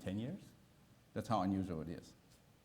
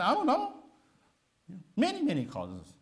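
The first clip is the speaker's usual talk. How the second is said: much higher, louder mock reply of a clueless doctor; a high, loud parody of the doctor saying he doesn't know; mock doctor shrug; 9 semitones higher, 6 dB louder than usual. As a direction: toss it off like a doctor dodging the question. Mocking the doctor's stock answer.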